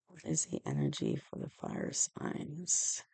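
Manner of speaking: soft, murmured question to the cards; hushed repeat. Real, not acted. Reader quietly asks the tarot deck about fire signs, hushed. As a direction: ask it again almost under the breath.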